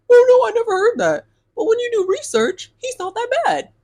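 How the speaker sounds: high, loud mock of his excuse; mocking his dodge. Imitating the date's excuse, a high mocking voice. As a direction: parody the date in a silly high voice. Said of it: "he was like".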